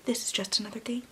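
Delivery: breathy dramatic whisper to close the blurb; hushed movie-trailer whisper; whispered teaser. Acted. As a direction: whisper it like a secret.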